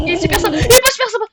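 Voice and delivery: high, loud laughing yell; laughing panic. Real, not acted. Player laughing in panic at the monster. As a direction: laugh and shriek at once.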